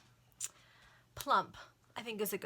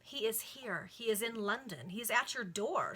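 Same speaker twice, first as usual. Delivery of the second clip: insistent beats on "He is here"; firm, building insistence up to "at your door"; emphatic; 3 semitones higher, 3 dB louder than usual. A narrator's reading. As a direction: press each "He is" statement home. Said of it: "said Louisa emphatically".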